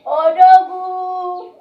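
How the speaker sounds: long drawn-out loud call. Acted. Calling out to Odogwu across the room, drawn-out shout. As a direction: stretch the name out in a big slow shout.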